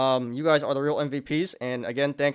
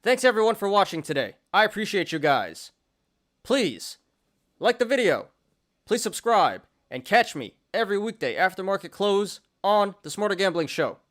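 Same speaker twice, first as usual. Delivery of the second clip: higher, bright show sign-off; upbeat outro; 6 semitones higher than usual. Acted. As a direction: wrap up the show with energy.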